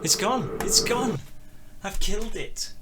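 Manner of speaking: high, loud exclamations of disaster; loud dismay. Real, not acted. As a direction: cry out that you have broken everything. Exclaims over technical mishap.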